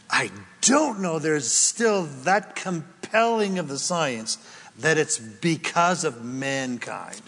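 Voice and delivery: usual speaking voice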